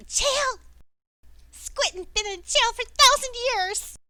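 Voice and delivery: high, loud little creature voice; pixie. Acted. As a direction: squeak it out like a tiny sea creature. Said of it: "a little voice in your head".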